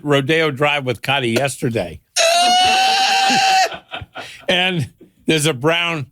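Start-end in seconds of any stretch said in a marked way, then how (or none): 2.0-4.2 shrieking laugh. Real, not acted. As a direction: let out a long piercing cackle. Cracking up at the story.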